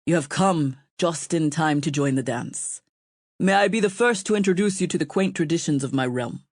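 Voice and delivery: lightly voiced courtly king, gracious; a hint of a charming host inviting her to dance; polished accent. A narrator's reading. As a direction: suave king welcoming a guest, understated. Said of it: "in a polished accent".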